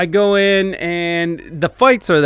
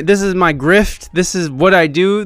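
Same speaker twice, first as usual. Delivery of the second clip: ordinary talk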